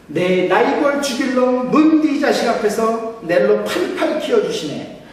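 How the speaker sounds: high, loud Gyeongsang dialect, blunt and hearty. Pretend. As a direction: boom it out like a gruff Busan man. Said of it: "경상도 버전입니다". (높고 크게 과장한 경상도 사투리)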